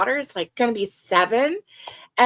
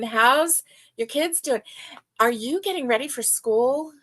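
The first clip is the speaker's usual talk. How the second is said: usual speaking voice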